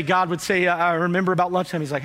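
usual speaking voice

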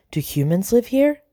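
lightly acted high mouse voice; anxious question. A narrator's reading. Mouse asking her friend about the house, mildly anxious. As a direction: pitch it up, rising into a worried question.

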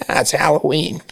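lightly hammed-up annoying take on Halloween, with "Hal"; mock annoying. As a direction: put a touch of mock irritation into "Hal-oween". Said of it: "Hal kinda sounds, like, annoying".

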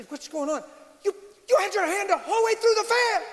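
high, incredulous onlooker; freaking out. Retold or imitated. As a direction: act out panicked disbelief, pitch climbing. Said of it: "they're freaking out".

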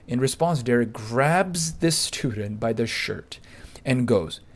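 ordinary talk

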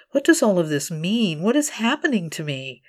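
ordinary delivery, nothing marked

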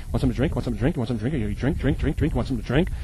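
pushy host imitation.

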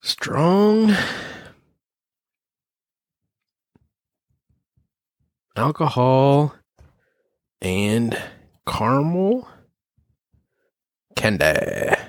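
swooping high drawn-out notes; dramatic sing-song. Shown as a demonstration. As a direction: stretch each word with big pitch swoops. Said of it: "Write that down".

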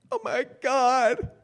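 high, slow wail through tears; sobbing despair. Retold or imitated. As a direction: wail it like a kid crying into her pillow. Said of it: "I sobbed".

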